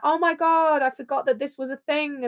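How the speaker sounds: high, whiny mock of a forgetful viewer. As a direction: whine it like a fan. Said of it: "being like".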